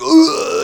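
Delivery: loud high choking gasp. Retold or imitated. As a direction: gag loudly as if poisoned. Player reacts to being poisoned.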